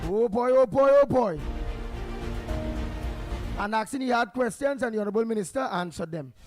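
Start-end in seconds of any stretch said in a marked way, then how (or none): none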